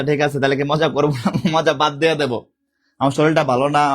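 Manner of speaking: ordinary talk, nothing marked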